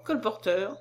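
ordinary delivery, nothing marked

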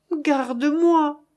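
slow, high, moaning plea. A narrator's reading. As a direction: beg to be taken in, groaning it slowly. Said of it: "gémit Florent".

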